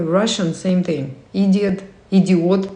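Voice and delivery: normal talking voice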